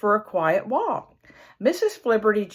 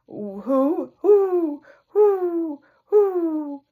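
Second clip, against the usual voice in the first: high hooting owl call; 8 semitones higher, 4 dB louder, much slower than usual. Acted. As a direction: hoot like an owl in the night.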